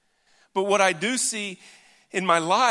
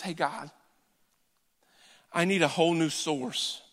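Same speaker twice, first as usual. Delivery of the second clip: quiet sincere prayer; 3 semitones lower than usual. Shown as a demonstration. Preacher showing true repentance, soft and sincere. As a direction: lower and softer, simple honest plea. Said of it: "Repentance is this".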